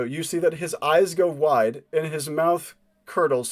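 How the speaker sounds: normal talking voice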